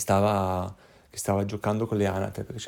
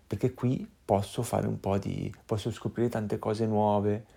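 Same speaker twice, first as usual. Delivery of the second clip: ordinary delivery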